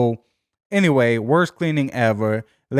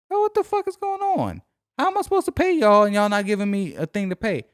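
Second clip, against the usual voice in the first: incredulous outburst; 7 semitones higher than usual. Retold or imitated. Telling a friend about the Geico app, shrieking in disbelief. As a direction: voice jumps way up in disbelief.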